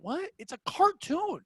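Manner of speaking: high, drawn-out incredulous quote; exasperated disbelief. Retold or imitated. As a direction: stretch the words in mock disbelief.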